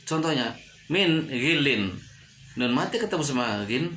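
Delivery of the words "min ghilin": "min ghilin" with a short clear n; slow "min ghilin", no hum; clear idhar reading. Shown as a demonstration. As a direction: cut "min" before "ghilin". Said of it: "tidak boleh dibaca".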